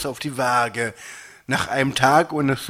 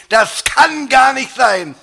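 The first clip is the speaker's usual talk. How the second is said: high, loud, stretched-out protest; shouting; 10 semitones higher, 6 dB louder than usual. A narrator's reading. Dieter disputes the scale's reading, shouting. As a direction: cry out in disbelief, slowly. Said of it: "schrie ich".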